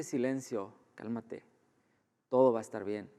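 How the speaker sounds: muy suave y más grave; en completa paz. Acted. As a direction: tranquilizar en voz baja a quien está gritando. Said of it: "Jesús está en completa paz". (voz serena, muy bajita)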